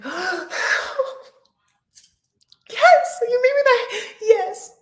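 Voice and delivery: high, squealing yes as if overjoyed; mock joyful squeal. The happy bride she did not sound like, high squeal. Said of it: "It isn't like".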